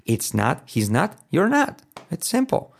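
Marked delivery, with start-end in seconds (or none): 1.3-2.7 emphatic high. Shown as a demonstration. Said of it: "mejor are not".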